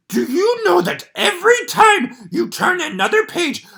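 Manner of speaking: a panicked puppet monster begging the reader; Grover voice. Acted.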